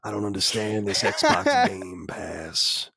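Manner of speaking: slow, drawling Southern cowboy grumble; a baffled old cowboy who has never heard of it; mock Southern cowboy. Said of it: "Red Dead voices".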